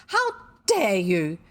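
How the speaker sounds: slow, loud, trembling outrage; shaking angry cry. A narrator's reading. Missus Biddle explodes at Anthea in rage. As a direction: let the fury shake the words. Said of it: "cried Missus Biddle, and her voice shook with angriness".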